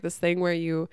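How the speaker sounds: ordinary talk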